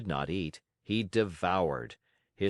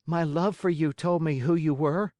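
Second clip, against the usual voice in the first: put-on high boyish puppet voice; a lightly voiced young puppet; Pinocchio voice; 8 semitones higher, 5 dB louder than usual. A narrator's reading. Pinocchio speaks.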